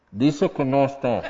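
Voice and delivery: disguised voice. Pretend. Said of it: "disfrazas tu voz".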